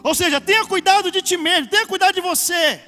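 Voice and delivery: loud, high, declaimed scripture; full-force preaching. Acted. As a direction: thunder the warning out to the congregation. Pregação a plena força. (pregação a plena força, voz alta)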